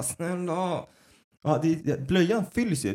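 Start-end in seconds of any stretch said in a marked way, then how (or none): 0.0-0.8 soft, higher mock plea; retold tired plea. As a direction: plead quietly, acting worn out. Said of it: "Och man bara". (återgiven trött, tyst vädjan)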